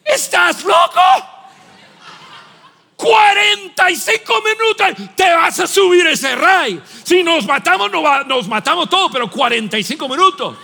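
loud, high exasperated shouting; mock outraged dad. Father retelling scolding his son in line, loud mock outrage. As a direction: yell at the kid half-laughing. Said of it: "mi reacción fue".

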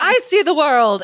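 high, eager dog character; excited dog. A narrator's reading. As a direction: cry it out like a happy pup. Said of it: "cried the curly-haired dog".

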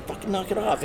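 high, sharp mock scold at the dog. Deputy scolds his drunk dog, high mock anger. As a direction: snap at the pestering dog in a retelling.

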